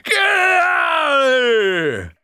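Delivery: furious shout to drive him off. Real, not acted. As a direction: yell it out, drawn-out. Yelling at intruder in basement.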